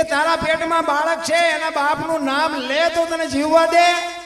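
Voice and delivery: loud, high, with the last words held long; saint's astonished question. Acted. Saint Murdas asking the girl, loud and incredulous. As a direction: ask it in loud disbelief.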